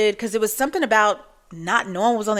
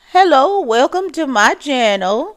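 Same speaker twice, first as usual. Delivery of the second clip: much higher, louder, slow channel welcome; old vlogger greeting; 6 semitones higher, 6 dB louder than usual. Pretend. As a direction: greet your viewers as an old lady. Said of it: "seventy-five-year-olds that talk like that".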